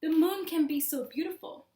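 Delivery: ordinary delivery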